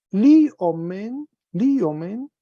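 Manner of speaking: liomen said high and loud, rising; Greek verb form. Shown as a demonstration. Teacher rereading the table for students, high and loud. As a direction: model liomen twice, rising. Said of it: "primera plural".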